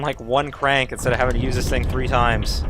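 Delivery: ordinary delivery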